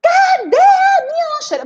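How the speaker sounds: put-on high, loud child's shout; child yelling. Rita shouting for her lunchbox, high and loud. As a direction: demand the lunchbox at full voice. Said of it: "tudo era motivo de gritaria".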